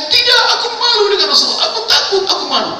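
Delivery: high, loud and frightened, pleading to be left alone; ashamed refusal. Acted. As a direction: cry out in shame and fear. Tha'labah refuses in shame. (Tha'labah menolak, malu dan takut)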